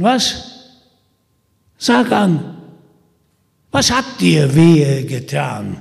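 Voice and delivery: lauter, höher spottender Versucher; verhöhn Peter mit schneidender Stimme; Holländer Michel, spöttisch. Acted. (Holländer Michel verspottet Peter, spöttisch und laut)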